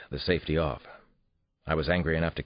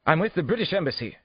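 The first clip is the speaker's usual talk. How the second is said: high-pitched, haughty declaration; indignant English official; 13 semitones higher, 4 dB louder than usual. A narrator's reading. Suspect tells detective his embassy standing, indignant declaration. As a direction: assert your rank in an English voice. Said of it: "Schaefer then stated".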